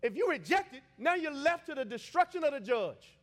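high, loud warning from the pulpit; full-force preaching. Real, not acted. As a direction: warn the congregation at full voice.

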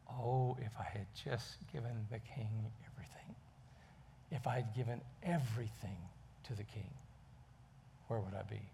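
whispered, hushed regret; regretful whisper. Acted. Beggar realizing what he missed, whispered regret. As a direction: breathe the regret almost silently.

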